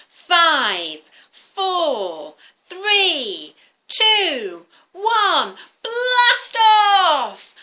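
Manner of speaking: loud, rising countdown ending in a big shout. Acted. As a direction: build each number to a blast-off cry. Rocket countdown for the children.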